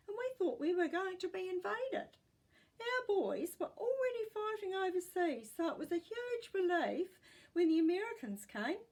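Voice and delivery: a hint of old fear in her voice; great gran. A narrator's reading. Great gran recalls wartime fear.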